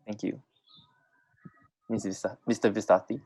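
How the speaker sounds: ordinary talk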